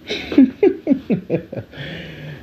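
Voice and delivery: high-pitched run of chuckles. Real, not acted. Podcaster laughing off his rant, high chuckle. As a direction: break into a high little laugh.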